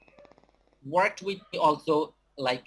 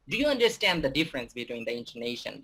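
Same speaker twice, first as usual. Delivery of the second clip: ordinary talk, nothing marked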